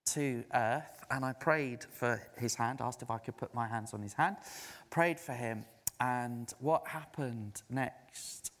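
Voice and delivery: normal talking voice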